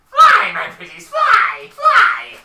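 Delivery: high, full-force commanding shout; Wizard of Oz villain. Acted. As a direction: shriek the order to flying minions. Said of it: "Wizard of Oz".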